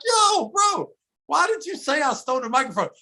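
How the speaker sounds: high, fast challenging question; confronting. Retold or imitated. Confronting the dude about the mic, high challenging.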